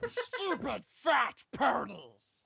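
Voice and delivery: high, loud, slow creature voice; slow, high mock cursing in a creature voice; Gollum. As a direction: stretch it out high, like a spiteful creature. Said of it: "That's Gollum".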